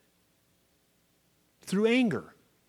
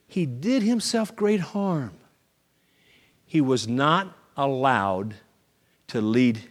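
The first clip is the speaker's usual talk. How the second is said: ordinary talk, nothing marked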